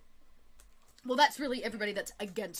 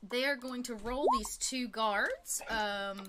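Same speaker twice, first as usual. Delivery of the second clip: ordinary talk, nothing marked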